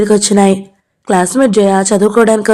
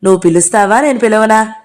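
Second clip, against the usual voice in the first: ordinary delivery, nothing marked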